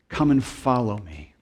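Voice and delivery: low, soft, soothing invitation; Jesus. Acted. Preacher voices the promise of rest to the weary, tender invitation. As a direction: offer rest gently, pitch sinking. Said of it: "Jesus say to us".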